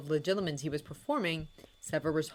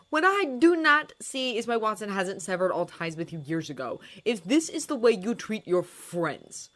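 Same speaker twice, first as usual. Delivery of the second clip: angry; 5 semitones higher, 7 dB louder than usual. A narrator's reading. Snape retaliating.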